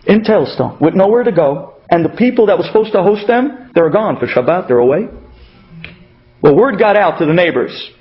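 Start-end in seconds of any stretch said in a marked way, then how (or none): none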